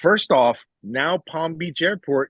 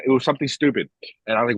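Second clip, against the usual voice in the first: ordinary talk, nothing marked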